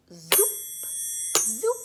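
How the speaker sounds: loud zoop, the second sliding up high; magic zoop sound. Acted. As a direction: zap it like a magic wand. Magic wand sound effect.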